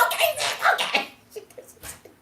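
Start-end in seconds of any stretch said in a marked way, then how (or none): 0.1-1.1 very high, loud imitation of giving in; mock yelled surrender. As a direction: yell it back like a fed-up sister. Sister gives in, yelling back.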